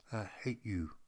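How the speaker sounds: soft, cold, hushed delivery. Acted. Reading a text message with no smile, cold. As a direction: drop it low and icy. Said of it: "those words are cold".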